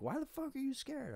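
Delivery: hollering at the top of his lungs, high; retold hollering. Drunk man at girlfriend, high mock hollering. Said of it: "hollering at the top of my lungs".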